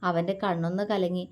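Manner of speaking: ordinary delivery, nothing marked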